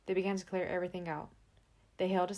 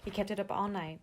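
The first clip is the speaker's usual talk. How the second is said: usual speaking voice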